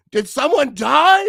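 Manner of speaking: imitating worried followers, hammed-up; mock alarm. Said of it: "people are like".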